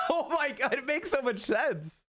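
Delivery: laughing disbelief. Real, not acted. Realizes something, laughs while talking.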